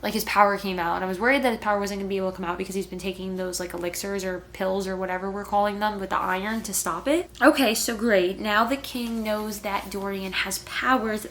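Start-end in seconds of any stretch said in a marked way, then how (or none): none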